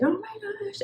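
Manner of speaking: put-on high whining little kid voice; whiny kid. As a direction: whine it like a spoiled child throwing a fit. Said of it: "he's like".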